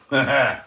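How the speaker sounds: loud, short chuckle; short laugh. Real, not acted. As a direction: laugh out briefly at your own joke. Teasing a viewer about chores.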